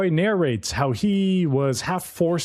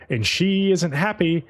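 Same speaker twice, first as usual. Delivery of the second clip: normal talking voice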